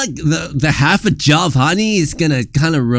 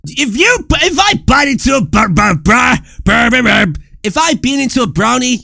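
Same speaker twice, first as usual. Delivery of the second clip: mock outraged shout; 7 semitones higher, 4 dB louder than usual. Indignant rant about bean brownies.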